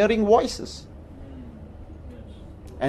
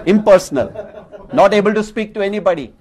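said through a laugh, louder; amused disbelief; 3 semitones higher, 4 dB louder, faster than usual. Real, not acted. Laughs at the memory.

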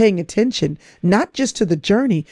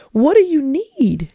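put-on higher, slow and tender, asking a small child; soothing a toddler; 7 semitones higher, slower than usual. Parent leaning in to a crying two-year-old, tender and high. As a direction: gently ask a crying child what they need. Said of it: "a little two-year-old just starts crying".

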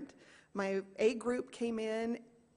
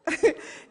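laugh; 8 semitones higher, 9 dB louder than usual. Real, not acted. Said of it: "laughing after singing".

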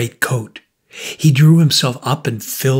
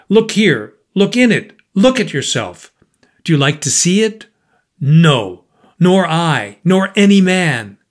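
louder, higher, pressing the point; louder insistent challenge; forceful confrontation; 5 semitones higher, 5 dB louder than usual. A narrator's reading. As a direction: push the mirror at him. Markheim confronts him with mirror.

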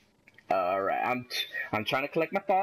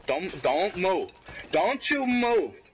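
slow, rising warning; drawn-out command; 4 semitones higher, much slower than usual. Real, not acted. Player ordering an enemy to freeze, drawn-out command. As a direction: stretch the words, climbing higher.

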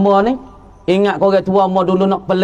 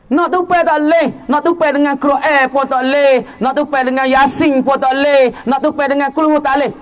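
fiery preaching; 4 semitones higher than usual. Acted. Preacher warning parents about a dying man, fervent. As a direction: preach it out with rising fervour.

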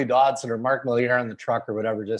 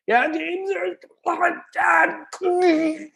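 strangled high voice, dragging slow; throat seizing as the words squeeze out; nervous reporter choking on air; much slower than usual. Retold or imitated. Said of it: "I can't talk".

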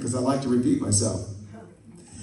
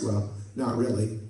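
blunt reply; 6 semitones lower, 5 dB quieter than usual. Retold or imitated. Acted blunt answer to friend.

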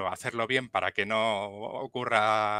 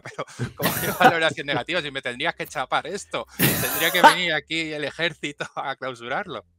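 mock outrage; 5 semitones higher, 5 dB louder than usual. Mock outrage at a bad review.

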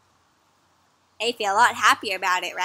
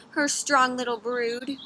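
plain reading voice

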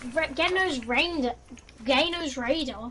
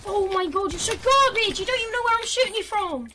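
high, loud, fast outburst; heated gamer trash talk; angry taunt; 6 semitones higher, 3 dB louder than usual. Real, not acted. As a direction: throw the insult in frustration. Angry insult during game quarrel.

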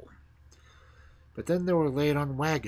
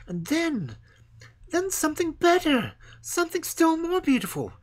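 much higher, louder yearning tree voice; longing young fir tree; 11 semitones higher, 5 dB louder than usual. Acted. The fir tree longs to be taken away, high and yearning. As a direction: pine for it like an impatient child.

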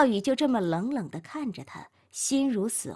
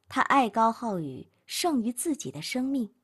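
plain reading voice

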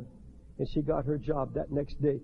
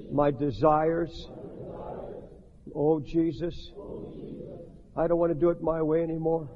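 leading prayer. Shown as a demonstration. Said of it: "pray this with me".

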